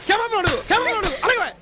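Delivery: father shouting at his daughter to apologize; shouted demand. Acted. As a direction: yell at her to apologize.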